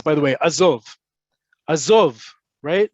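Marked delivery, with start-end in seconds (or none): 0.4-0.8 "Azov" with the stress on "zov", falling; last-syllable emphasis. Shown as a demonstration. Teacher models telling someone to back off, "Azov" stressed on the end. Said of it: "The emphasis on the last syllable". 1.7-2.2 "Azov" louder, stress on "zov", falling; last-syllable emphasis. Shown as a demonstration. Teacher repeats the back-off command, "Azov" landed loudly on the last syllable. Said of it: "The emphasis on the last syllable".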